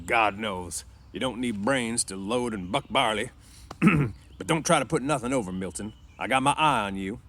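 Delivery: loud, firm ranch boss; warning boss. A narrator's reading. Boss warning a new worker, suspicious. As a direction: lay down a warning to the new hand.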